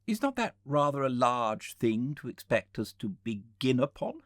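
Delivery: doubting guest pushing back; argumentative Philby. Acted. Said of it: "an argumentative person".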